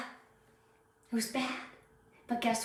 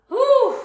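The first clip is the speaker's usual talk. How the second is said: loud high whew; 14 semitones higher, 10 dB louder than usual. Real, not acted. After joking about vain teammates.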